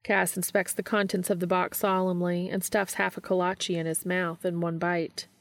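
ordinary talk

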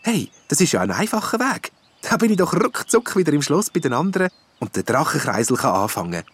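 delighted the way looks easy; pleased. A narrator's reading. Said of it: "freut sich der Hofnarr".

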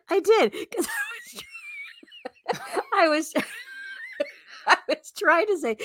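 squealing laughter through words; can't get it out for laughing; laughing hard. Real, not acted. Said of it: "you heard that?".

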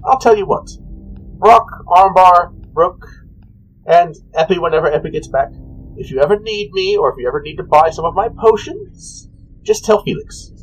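high-pitched, sing-song character voice; high, airy shopkeeper; cheery potion seller. Acted. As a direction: pitch it up like an obliging little merchant.

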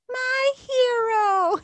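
very high, slow, loud swoon with a laugh; swooning damsel. Acted. Rescued damsel gushing at her hero, very high swoon. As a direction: gush over him like a cartoon damsel. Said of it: "And she goes".

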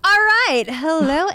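high, loud bright greeting; bubbly high-energy welcome; bubbly hello. Shown as a demonstration. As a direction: greet them like a cheerful host. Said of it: "when I said hello just now".

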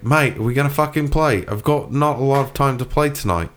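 impatient British-style gamer mate; put-on accent. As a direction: press them in a mock British accent. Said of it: "that's how I can learn it".

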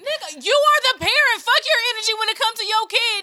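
loud, high, heated scolding; angry rant. Real, not acted. Ranting at the father who scolded his hungry daughter, loud and furious. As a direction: let him have it, voice up and loud.

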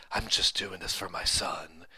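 lightly voiced badass hero; mock gruff hero. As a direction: say it low like a would-be badass. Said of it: "supposed to sound cool and badass".

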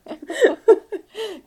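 high loud cackle; witch cackle. Pretend. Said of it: "I was being like Hansel and Gretel".

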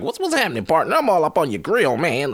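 high, loud rapper impression in West Coast slang. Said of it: "'cause E four is like".

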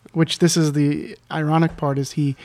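normal talking voice

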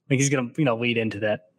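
normal talking voice

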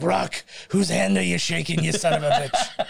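mock-irate character voice, breaking into laughter; Mickey. Mickey catches Rock shaking a stranger's hand, mock scolding. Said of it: "Mickey comes from the other side. He's like".

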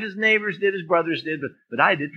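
normal talking voice

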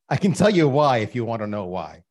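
ordinary delivery

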